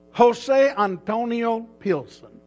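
"Jose Antonio Pilson" said slowly and high, Spanish style on "Jose Antonio"; Spanish name pronunciation. Shown as a demonstration. Pastor spells out his cousin's name with a Spanish ring. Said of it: "it works good for Spanish name on the Jose Antonio".

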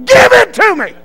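very high, full-force yell; furious command. Retold or imitated. Officer repeats the demand louder.

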